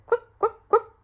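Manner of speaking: high, loud clucking hen. Acted. Storyteller clucking as the farm hen. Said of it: "the hen said".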